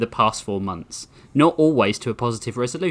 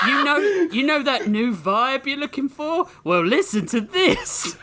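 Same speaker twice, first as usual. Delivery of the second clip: teasing; 13 semitones higher than usual. Real, not acted. GM teasing the bad joke.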